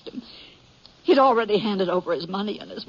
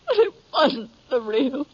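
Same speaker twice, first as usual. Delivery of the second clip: breaking into tears; 7 semitones higher, 3 dB louder than usual. Acted. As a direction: voice cracks into a cry on the last words. Widow breaks down over the belt.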